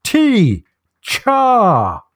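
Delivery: booming bosun offering tea; loud voice to a foreigner. Retold or imitated. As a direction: call it out like a sailor to a foreigner. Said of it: "invites him in a loud voice".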